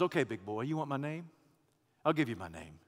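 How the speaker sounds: low, quiet, knowing reply; a soft, teasing divine answer; God answering. Acted. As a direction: drop it low like a challenge met.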